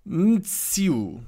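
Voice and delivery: "Mitsu" high and drawn out, falling; tentative pronunciation. Shown as a demonstration. Reactor trying out the artist's name, "[name]" drawn out high and falling. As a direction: sound out "Mitsu" slowly, pitched high. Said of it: "not sure how to pronounce his name".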